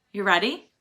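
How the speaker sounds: plain reading voice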